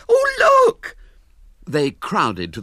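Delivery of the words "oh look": very high, loud shriek; horrified girl shrieking at the window; scream. Acted. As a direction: shriek in horror. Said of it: "she uttered a scream".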